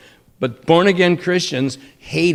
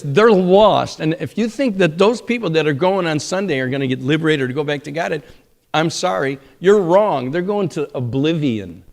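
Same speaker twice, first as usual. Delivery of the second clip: ordinary delivery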